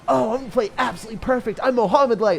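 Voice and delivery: lightly hammed-up know-it-all bragging; cocky pro player. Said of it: "just, like, being like".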